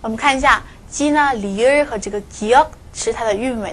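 ordinary talk, nothing marked

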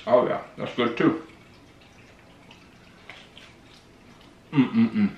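chewing while talking; humming between bites; mouth full. Real, not acted. Seen 2: holds bread, bites at 2.6-3.4, chews while humming.

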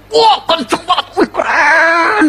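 high-pitched whoop, last word stretched out; thrilled rider. Acted. Rider thrilled by his galloping horse, excited shouting.

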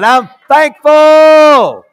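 high, loud, hugely drawn-out last word; exultant shout. Real, not acted. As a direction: shout it as the climax of thanks. Climax of repeated thanks.

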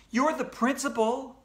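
put-on high, insistent child's protest; upset appeal. A narrator's reading. Upset pupil appeals to principal.

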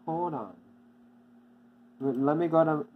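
ordinary delivery

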